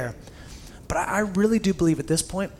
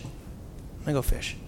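slower, softer, dropped almost to a whisper; withdrawn mutter; 8 dB quieter, slower than usual. Retold or imitated. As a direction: say it like he is pulling away from everyone. Peter retreating to fishing.

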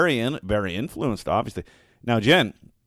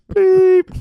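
loud high beep sound; beeping out a name; 11 dB louder than usual. Pretend. Host censoring a listener's name with a loud high beep. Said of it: "I'm beeping out names".

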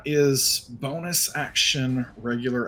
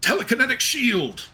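high-pitched heroic incantation; pitched-up call, falling on the last word; spell call; 13 semitones higher than usual. Acted. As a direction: announce the power like a cartoon hero. Acting character's spell call.

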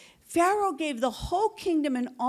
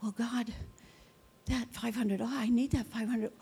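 softer, half-whispered fretting over money; worried whisper; 2 semitones lower, 6 dB quieter than usual. Retold or imitated. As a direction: mutter the anxious prayer under your breath. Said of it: "not worry about".

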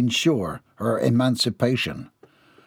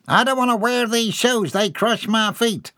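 put-on whiny young man; 10 semitones higher than usual. The son whines to his mother about his shoes. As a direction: complain like a sulky country boy.

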